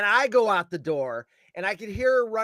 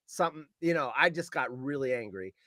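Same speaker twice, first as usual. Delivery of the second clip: usual speaking voice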